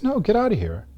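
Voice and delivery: very high-pitched dismissive sister; imitating sister. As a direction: refuse your brother in a high voice. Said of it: "She goes".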